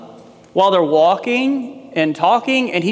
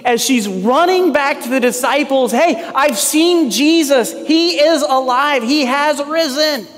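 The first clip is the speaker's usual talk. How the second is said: excited Mary; 6 semitones higher than usual. Pretend. Mary running back to the disciples, breathless with excitement. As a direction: burst out with the news of the risen Lord. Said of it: "you can just imagine the excitement of Mary".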